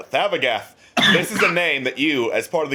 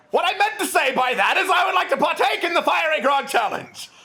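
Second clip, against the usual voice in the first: eccentric prince; 13 semitones higher than usual. Acted. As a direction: burst into the tavern as a flamboyant royal. GM voices Prince Zordan.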